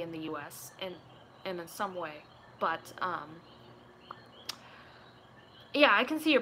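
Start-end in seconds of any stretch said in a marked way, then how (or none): none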